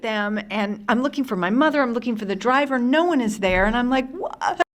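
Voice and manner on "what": startled question. Retold or imitated. Scared child confused in the street.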